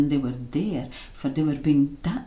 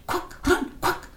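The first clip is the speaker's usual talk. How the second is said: urgent. A narrator's reading. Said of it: "Robbie screamed".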